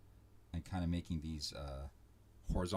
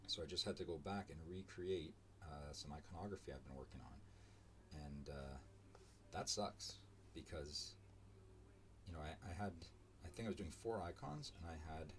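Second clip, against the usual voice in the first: mumbling; 9 dB quieter than usual. Real, not acted. Streamer rambling to unseen viewers, mumbling. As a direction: mutter along as if nobody is listening. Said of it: "I'm kinda mumbling a little bit".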